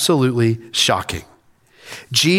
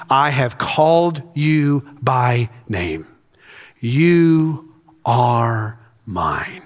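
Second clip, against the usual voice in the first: slow, measured Bible recitation; weighty, deliberate reading with long final words; solemn scripture reading; slower than usual. Acted. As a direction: read it as God's solemn promise, slowing to the end. Reads Isaiah aloud in sermon.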